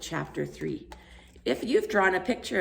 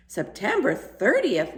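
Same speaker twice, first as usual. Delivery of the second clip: "September thirtieth" slow, higher and louder, stressed on "thirtieth"; husband realises the date, excited; 6 semitones higher, 5 dB louder, slower than usual. A narrator's reading. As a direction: seize on the date with sudden excitement. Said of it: "said in an excited tone".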